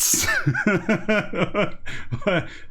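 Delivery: amused chuckle; laugh. Real, not acted. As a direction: break into a laugh. Laughs at own whisper.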